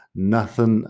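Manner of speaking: "Nothing" ending in a plain n, no g sound; nothing without the g. Shown as a demonstration. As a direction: say "Nothing" as naθən, dropping the final g. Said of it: "sin la G al final". ("Nothing" sin la G al final, terminado en n)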